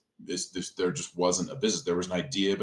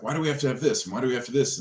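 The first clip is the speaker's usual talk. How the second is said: higher-pitched mock protest; retold exasperated questioning; 6 semitones higher, 3 dB louder, much faster than usual. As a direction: replay your own pestering questions, pitched up. Retells pestering the engineer with questions.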